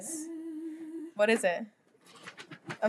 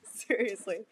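normal talking voice

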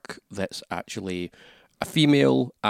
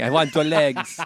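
a hammed-up, higher French accent, laughing; a clumsy French accent, lifting the pitch; bad French accent; 5 semitones higher than usual. Joking about a Frenchman chasing the frog, hammed-up French accent. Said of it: "That wasn't a French accent".

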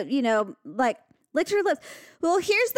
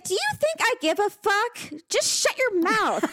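angry; 10 semitones higher than usual. Retold or imitated. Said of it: "I'd be like".

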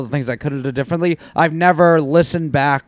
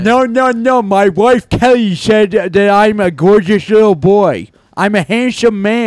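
higher, louder goofy character; mock voice; 5 semitones higher, 5 dB louder than usual. Co-host keeps the mocking character going, higher and louder. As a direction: overdo the bragging like a simpleton.

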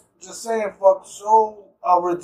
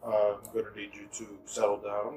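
security guy imitation; 12 semitones lower, 11 dB quieter than usual. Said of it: "he was like".

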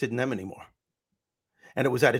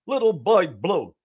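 slow, higher put-on comic voice; Andrew Dice Clay line; 6 semitones higher, 5 dB louder, slower than usual. Comedian repeating a line he gave Andrew Dice Clay, slow put-on voice. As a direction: deliver the joke line as the comic did. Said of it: "this is one of his lines".

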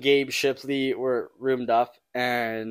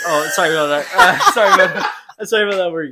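laughing loudly through a sorry; real laughter while apologizing to listeners; laughing apology; 3 semitones higher, 6 dB louder, faster than usual. Real, not acted.

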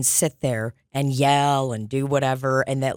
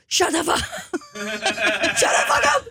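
high mock yell breaking into laughter; cracking up mid-scold; laughing mock yell; 5 semitones higher than usual. Laughing while retelling telling a friend to shut up. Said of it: "I go".

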